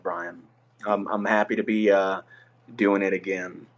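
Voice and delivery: ordinary talk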